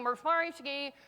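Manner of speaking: garbled, mumbled gibberish; unintelligible announcer. Retold or imitated. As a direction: imitate a voice you can't make out. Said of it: "'cause I can't hear anything".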